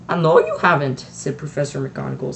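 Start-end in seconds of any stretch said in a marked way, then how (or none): 0.1-1.0 louder, half exasperated, half admiring. A narrator's reading. Strict witch to the fearless headmaster, exasperated. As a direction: sigh it out, exasperated yet admiring. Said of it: "sounding half exasperated, half admiring".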